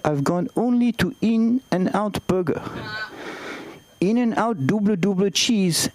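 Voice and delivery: put-on light French accent throughout; lightly put-on Parisian French accent; Jean-François French accent. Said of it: "Make some noise for Jean-François".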